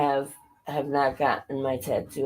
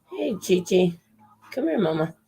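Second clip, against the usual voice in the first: higher-pitched pet call; calling the cat; 4 semitones higher than usual. Real, not acted. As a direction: coax a cat to come over. Calling her cat over.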